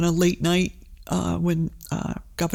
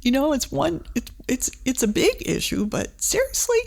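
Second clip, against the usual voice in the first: incredulous; 6 semitones higher than usual. Retold or imitated. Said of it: "And I was just like".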